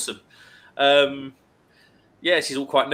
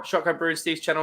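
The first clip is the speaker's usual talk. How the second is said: ordinary delivery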